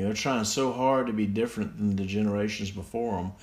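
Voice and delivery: ordinary talk, nothing marked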